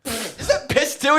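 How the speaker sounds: high slow horrified squeak; mock horrified Charlie. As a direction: drag it out high in disgust.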